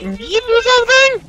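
very high squeaky comeback; squealed retort. Real, not acted. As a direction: throw it back in a squeal. Throws the demand back at partner.

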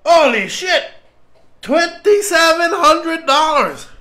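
high, loud, thrilled; excited shout. Real, not acted. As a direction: shout it out, overjoyed. Reacting to big donation.

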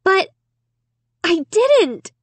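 high, halting protest; choked out. A narrator's reading. Accused girl denying it to family, choked out. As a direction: force out the denial in distress. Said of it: "I finally choked out".

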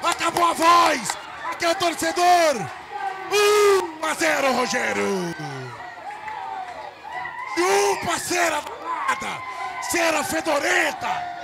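screaming goal call, long held; explosive goal commentary. Real, not acted. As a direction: roar the goal, drawn out.

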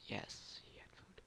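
hushed breathless whisper. Real, not acted. Player catching breath after the kill, whispering. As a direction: whisper it, heart still pounding.

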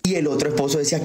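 normal talking voice

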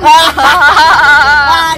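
breathy mocking laugh at the captive. Real, not acted. Captor laughing at the captive slapping himself, mocking. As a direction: laugh at him, enjoying it.

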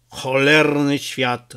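ordinary delivery

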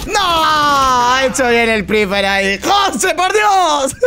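long drawn-out "no", then high cries; exasperated shout. Real, not acted. Streamer shouting at José after losing the round, exasperated. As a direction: shout it in high-pitched disbelief.

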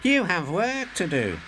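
usual speaking voice

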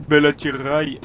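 put-on accidental-sounding Russian accent with rolled r; slightly Russian-accented French. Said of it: "un bel accent russe, je crois". (léger accent russe)

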